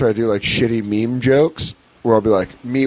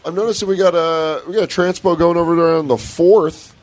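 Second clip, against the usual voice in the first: casual coworker voice; 6 semitones higher than usual. Retold or imitated. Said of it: "he goes".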